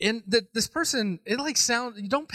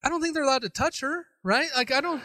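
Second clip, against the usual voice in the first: worried bystander; 7 semitones higher, faster than usual. Retold or imitated. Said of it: "sitting on the sideline going".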